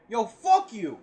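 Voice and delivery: loud mock insult; punchy imitation of a blunt curse; retold cursing outburst. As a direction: throw it out loudly, imitating him. Quotes the other's outburst.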